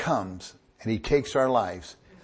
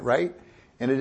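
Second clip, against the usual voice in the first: ordinary talk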